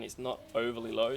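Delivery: ordinary delivery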